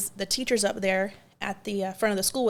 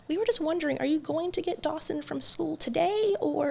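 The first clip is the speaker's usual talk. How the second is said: higher, sweet polite lady; nice lady voice; 7 semitones higher, 4 dB quieter than usual. Retold or imitated. School lady asks about Dawson, higher sweet voice. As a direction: sing it up like a kind school lady. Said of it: "she's like".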